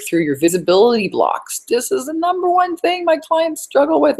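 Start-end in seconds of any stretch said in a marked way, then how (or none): 1.6-4.2 put-on high voice. Sudden high put-on voice mid-pitch.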